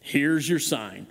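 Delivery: slow, drawn-out punchline delivery; deadpan Here's your sign, slow; slow comedian catchphrase, low and deadpan; Engvall catchphrase. Retold or imitated. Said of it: "that line was".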